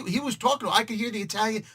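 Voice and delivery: ordinary delivery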